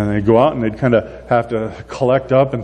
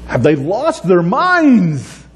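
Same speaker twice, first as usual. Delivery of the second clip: disbelief; 8 semitones higher, much slower than usual. Retold or imitated. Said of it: "I read that story and I'm like".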